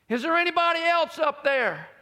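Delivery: high, loud disbelieving yell; comic desperate shout. Retold or imitated. Dangling man asks for anyone else, shouting in disbelief. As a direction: holler back up, wanting a different rescuer. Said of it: "then you hear him say".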